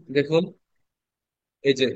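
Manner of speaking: ordinary delivery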